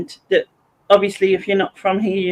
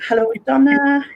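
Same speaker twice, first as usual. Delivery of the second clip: normal talking voice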